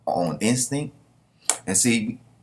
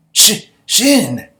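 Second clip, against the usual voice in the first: high, loud stammered cry of a name; stuttered shouted name; startled shout; 12 semitones higher, 8 dB louder than usual. Acted. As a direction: shout it as he rushes past. Character yells as Shin passes.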